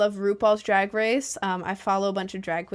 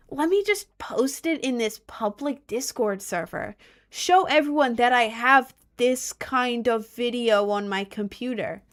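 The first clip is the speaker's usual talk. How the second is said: higher, louder put-on voice; mocking voice; 3 semitones higher, 4 dB louder than usual. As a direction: sneer like a smug troll. Mocking the trolls' thinking.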